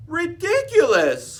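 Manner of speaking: high, loud, slow scoff; both parents crying out at once; cried together. Acted. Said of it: "cried Mister and Missus Tortoise together".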